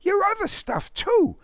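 put-on high boyish voice giving advice; advising. A narrator's reading. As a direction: advise a friend, pitched well up. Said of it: "Derek advised".

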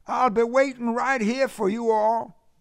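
high-pitched Southern caller; Southern accent. A narrator's reading. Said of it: "His southern accent convulsed them".

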